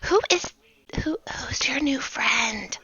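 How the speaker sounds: hushed, unvoiced aside; whisper. Bitsy quietly asking about the newcomer. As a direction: lean in and whisper it.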